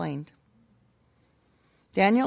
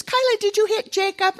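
high, stern-but-kind mom voice; niece questioning; 14 semitones higher, 5 dB louder than usual. Retold or imitated. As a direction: question the little girl gently.

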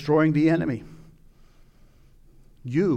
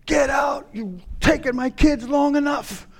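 high, loud outraged yell; furious shout; 12 semitones higher, 6 dB louder than usual. Acted. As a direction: scream at an intruder to get out. Acted confrontation driving out the enemy.

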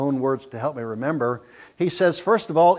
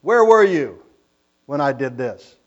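God demanding answers from Job, loud and commanding; God's thunderous challenge; 7 dB louder than usual. Acted. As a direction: call him out like an angry God. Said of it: "he humbles Job before God".

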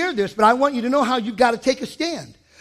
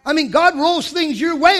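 ordinary talk, nothing marked

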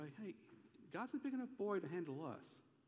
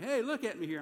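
higher, louder fatherly summons; calling for attention; 6 semitones higher, 5 dB louder than usual. Retold or imitated. Father to his boys in hats, calling for attention. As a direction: get a child to look up.

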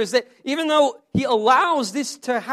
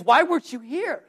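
mock reproach; 4 semitones higher than usual. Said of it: "Really what she's saying is".

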